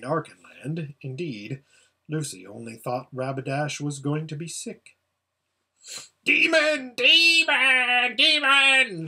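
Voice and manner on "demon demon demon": high, loud repeated shriek. A narrator's reading. The prince shrieking at Aslan, high and loud. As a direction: shriek it out in terror and rage. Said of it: "shrieked the prince".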